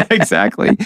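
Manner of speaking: laughing while agreeing; chuckled agreement. Real, not acted. Laughing at the joke.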